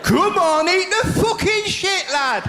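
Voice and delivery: high, hammed-up Northern yell with laughter; Northern shout. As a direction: bellow it like a Northern hard man urging him on. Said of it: "I can do Northern".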